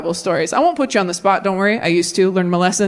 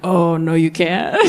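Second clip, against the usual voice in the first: low, knowing refusal; adult woman; 5 semitones lower, slower than usual. Retold or imitated. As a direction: rule it out as a grown woman, pitched low. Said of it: "as an adult woman now".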